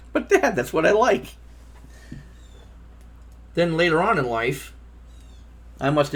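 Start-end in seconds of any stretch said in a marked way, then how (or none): 0.1-1.4 put-on high-pitched plea of a scolded child; protesting kid. Son answering his father's scolding, protesting. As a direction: plead your case to Dad.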